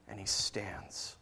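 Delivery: whispered and slow for drama; hushed whisper. Acted. Dramatic pause in sermon.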